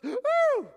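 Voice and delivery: high, loud yelp of shock; startled whoop. Retold or imitated. Girl sees Peter at the door, high loud shocked whoop. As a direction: let out a sudden shrieking woo. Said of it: "and she goes".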